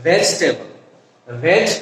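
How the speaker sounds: ভেজিটেবল as vej-tebol, then ভেজ alone; American contracted pronunciation. Shown as a demonstration. Teacher modelling the correct form, ভেজিটেবল as vej-tebol. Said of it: "উচ্চারণটা হচ্ছে কি".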